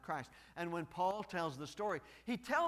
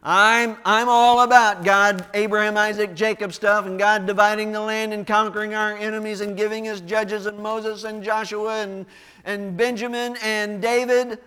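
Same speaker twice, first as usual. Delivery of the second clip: loud, higher, hammed-up objection; loud mock crowd pushing back; mock protest; 5 semitones higher, 19 dB louder than usual. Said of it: "that's when they said".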